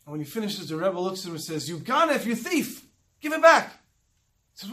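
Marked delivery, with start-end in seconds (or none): 1.7-3.7 raised, louder accusation building to a shout; retold angry accusation. Rebbe exposes a thieving partner, loud retold accusation. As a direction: accuse the thief, then punch the demand out high and loud.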